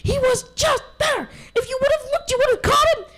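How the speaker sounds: very high, loud complaining shout; whiny protest. Acted. Little wolf complaining to dad, high loud protest. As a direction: protest that he was right.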